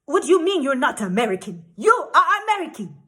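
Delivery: mock outraged insistence; flustered, angry official. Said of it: "flustered and angry. He was like".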